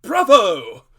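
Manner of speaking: loud, high cheer of delight; the squire cheers the brave tale with a loud shout; shouted bravo. A narrator's reading. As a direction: burst out with a booming bravo. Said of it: "the squire cried".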